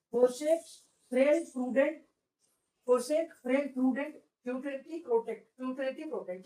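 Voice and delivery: slow listing. Shown as a demonstration. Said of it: "reads the word list back".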